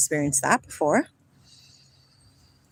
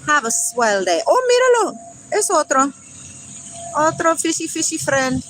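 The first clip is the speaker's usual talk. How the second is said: higher, louder cheery hello; playful greeting; 6 semitones higher, 3 dB louder than usual. Real, not acted. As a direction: call out sweetly to the passing boat.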